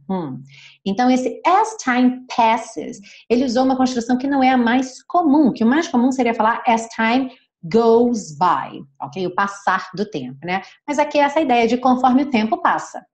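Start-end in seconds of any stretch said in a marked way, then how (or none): 1.5-3.0 as time passes said slowly; slow quoted phrase. Shown as a demonstration. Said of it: "slow quoted phrase". 6.7-8.8 as time goes by said slowly; slow quoted phrase. Shown as a demonstration. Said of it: "slow quoted phrase".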